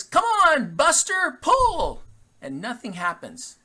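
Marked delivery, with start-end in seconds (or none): 0.0-2.0 loud, high holler at the horse; retold farmer bellowing at his horse; farmer's yell. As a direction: shout the horse on to pull, much higher and louder, slow. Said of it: "And then he says".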